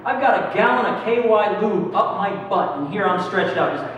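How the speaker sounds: normal talking voice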